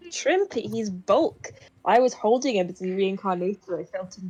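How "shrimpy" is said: indignant surprise. Real, not acted. Indignant echo.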